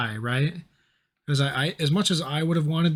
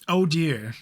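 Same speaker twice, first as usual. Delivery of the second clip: prim British voice; 3 semitones higher, slower than usual. Retold or imitated. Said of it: "Every British person should be like".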